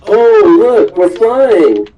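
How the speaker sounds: hammed-up joy at flying through the air; gleeful Luffy. Luffy thrilled as the ship goes flying, gleeful cry. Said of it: "Luffy's like".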